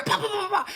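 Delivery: high, rapid popping; playful high-pitched pops; imitated gunshots. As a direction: mimic a burst of gunfire. Imitates gunfire in story.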